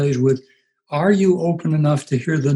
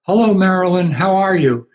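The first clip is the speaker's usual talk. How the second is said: loud, higher call across the lobby; yelled greeting; 5 semitones higher, 5 dB louder than usual. Retold or imitated. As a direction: call out cheerfully from a hundred feet away. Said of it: "I just yelled out".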